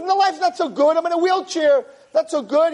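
high-pitched cranky retort; indignant storyteller's old-man complaint; acted bickering. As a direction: argue back up high like a bitter old man.

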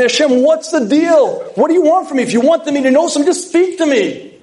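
high, pleading complaint to God; exasperated plea. Acted. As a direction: cry out in frustration. Troubled person pleads with God.

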